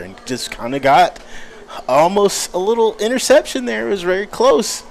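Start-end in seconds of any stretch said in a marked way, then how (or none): 1.9-4.8 excited. Real, not acted. Near interception call.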